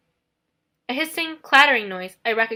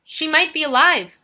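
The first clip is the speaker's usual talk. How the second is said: higher, louder, pleading; desperate; 5 semitones higher, 6 dB louder than usual. Acted. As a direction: plead desperately to calm the boy. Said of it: "I said desperately".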